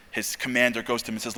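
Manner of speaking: normal talking voice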